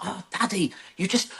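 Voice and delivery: put-on high-pitched exasperated little boy; frustrated child. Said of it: "got increasingly frustrated with me".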